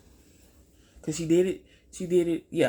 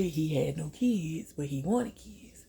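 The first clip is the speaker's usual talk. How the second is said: usual speaking voice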